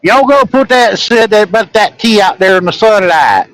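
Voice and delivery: high, loud Southern voice; southern drawl. Retold or imitated. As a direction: put on a thick Southern twang. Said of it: "it's a southern thing".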